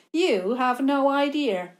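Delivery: loud scornful sneer; mock him openly. Villain scoffs at Theo.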